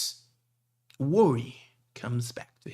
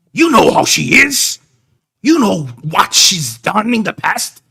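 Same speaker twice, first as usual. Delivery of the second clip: angry scolding; 5 semitones higher, 15 dB louder than usual. Acted. Big man scolding the young man, furious and loud. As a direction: bellow at him for being so foolish. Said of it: "the big man goes".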